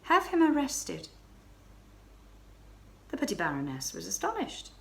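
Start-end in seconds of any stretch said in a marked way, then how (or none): 0.0-1.1 abrupt. Acted. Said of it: "she said abruptly".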